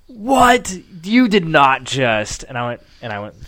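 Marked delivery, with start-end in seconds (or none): 0.1-2.4 What shot up high and loud, then slow disbelief; shocked wife. Retold or imitated. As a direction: gasp out What then drag You did not just. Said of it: "she was like".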